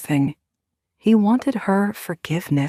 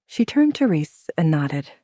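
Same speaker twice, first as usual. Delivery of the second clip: ordinary delivery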